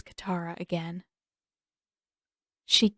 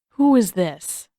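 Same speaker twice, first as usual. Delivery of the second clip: louder, cold suspicious woman; cold mother; 7 dB louder, slower than usual. A narrator's reading. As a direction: turn icy and suspicious. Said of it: "eyes went cold".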